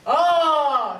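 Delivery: breathy oh. Shown as a demonstration. Teacher asking the class to copy, breathy oh. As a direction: breathe in and let out oh. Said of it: "and you go".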